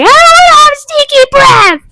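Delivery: put-on very high, loud whining child; whiny upset kid. Babysat girl refusing stinky breath, high loud whine. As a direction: wail it like a pissed-off little girl. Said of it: "she was like".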